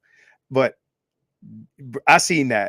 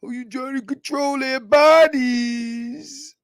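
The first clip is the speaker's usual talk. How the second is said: mock outrage; 9 semitones higher, 5 dB louder, much slower than usual. Imitating women's reaction.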